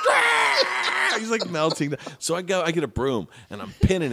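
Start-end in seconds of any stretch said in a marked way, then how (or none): 0.1-1.2 mock scream. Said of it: "And he's like".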